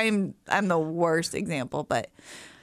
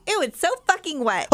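high-pitched child imitation; disgusted little girl; 9 semitones higher than usual. Mom quoting her young daughter swearing, high disgusted voice. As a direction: mimic a small girl grossed out. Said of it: "She goes".

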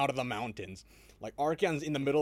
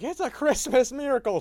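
high, loud gleeful exclaim trailing off; marvel, then undercut it; mock delighted wonder; 13 semitones higher, 10 dB louder than usual. Rescued Empire gushing about the orcs, mock delight.

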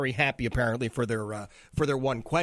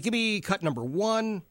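usual speaking voice

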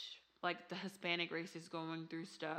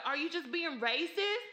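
high mock accusing mother; 10 semitones higher, 4 dB louder than usual. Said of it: "she's like".

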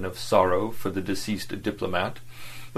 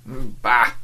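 scornful bah; 3 semitones higher, 5 dB louder than usual. A narrator's reading. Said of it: "said the latter".

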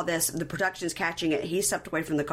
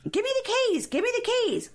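imitated yelling. Retelling her yelling to him in the car, high mock yell. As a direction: mimic her shrieked demand, pitched way up. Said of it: "she is yelling to him".

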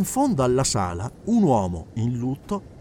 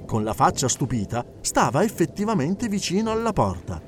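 usual speaking voice

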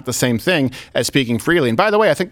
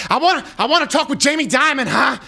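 demanding [name]; 10 semitones higher than usual. Retold or imitated. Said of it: "[name] just walked into a bank like".

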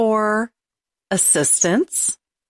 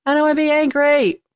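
louder, higher, fed up; frustrated outburst; 6 semitones higher, 3 dB louder than usual. Real, not acted. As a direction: blurt it out in frustration. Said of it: "am I getting angry again?".

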